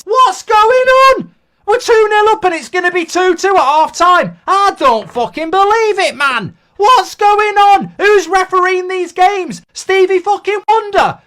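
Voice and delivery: loud, incredulous swearing at the ref; furious rant. Real, not acted. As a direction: shout your disbelief, dragging out the question. Fan raging at the referee.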